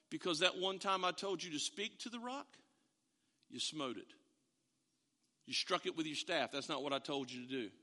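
calm rebuke spoken down low; God quietly reminding Moses why; God's quiet answer. Retold or imitated.

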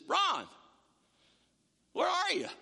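high incredulous question to a no-show; exasperated call. Retold or imitated. Youth minister on the phone asking Ron where he is, incredulous. Calling the minister who forgot.

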